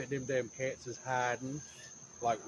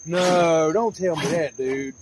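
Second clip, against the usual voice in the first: loud dismay; 4 semitones higher, 9 dB louder, slower than usual. Real, not acted. Something broke on the mower.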